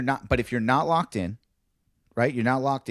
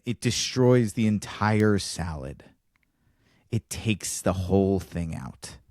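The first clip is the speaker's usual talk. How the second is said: ordinary talk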